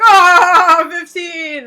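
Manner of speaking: mock leering oh. Men react to her age.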